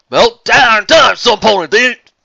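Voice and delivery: loud, high, slow southern call; crying out. Acted. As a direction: yell it from the back of the hall. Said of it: "someone in the back crying out".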